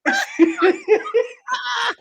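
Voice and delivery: high-pitched laughing fit; real laughter. Real, not acted. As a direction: crack up at your own story. Cracking up at own accent story.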